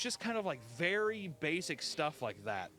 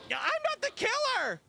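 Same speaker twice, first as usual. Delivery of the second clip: flat Velma yell; 8 dB louder than usual. Retold or imitated. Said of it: "no real emotional resonance when she's yelling".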